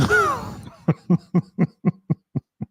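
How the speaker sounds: real high-pitched laughing fit; big laugh. Real, not acted. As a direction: burst out laughing, high and long. Laughing at a chat comment.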